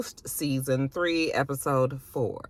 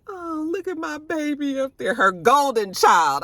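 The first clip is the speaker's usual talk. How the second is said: higher, doting voice; proud aunt; 9 semitones higher than usual. Retold or imitated. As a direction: gush over the billboard like a proud aunt. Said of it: "she was just like".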